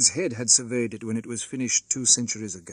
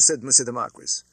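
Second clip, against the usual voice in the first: plain reading voice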